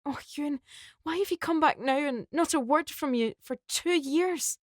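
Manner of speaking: high, upset pleading through tears; tearful shock. Acted. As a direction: cry out his name in disbelief. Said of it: "with tears streaming down her face".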